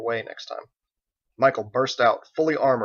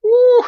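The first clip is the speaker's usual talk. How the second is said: very high, loud squeal of glee; delighted shriek; 16 dB louder than usual. Acted. Margot shrieks as chicken hits his face, loud squeal. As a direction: squeal before bursting into laughter. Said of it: "and started laughing".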